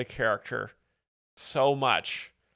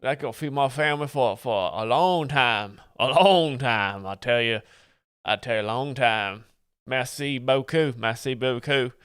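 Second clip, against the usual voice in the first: put-on Cajun accent. Streamer thanking a viewer for bits, comic Cajun accent. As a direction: ham up a grateful Louisiana Cajun.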